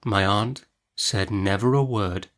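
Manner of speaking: ordinary delivery, nothing marked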